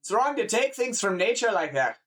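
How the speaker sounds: high, scolding Scottish farmer. Acted. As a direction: tell them off as a Scottish countryman. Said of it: "like a Scottish person or whatever".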